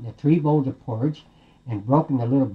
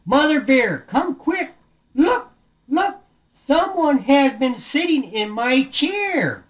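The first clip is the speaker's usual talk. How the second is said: high, urgent story-bear call; alarmed Daddy Bear; 12 semitones higher, slower than usual. Acted. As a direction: call out the discovery, slowing at the end. Daddy Bear calls about his chair.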